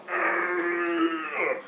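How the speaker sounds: long, high, thin straining groan. Retold or imitated. Dreamer retelling pulling out of glue, drawn-out high straining groan. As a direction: imitate hauling yourself out of something sticky.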